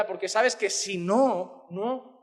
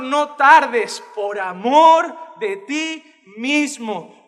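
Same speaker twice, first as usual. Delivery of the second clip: slow, loud pleading to the Lord; impassioned prayer; 5 dB louder, much slower than usual. Acted. Preacher voicing a prayer for mercy, slow impassioned pleading. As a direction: cry out each plea, drawing it out.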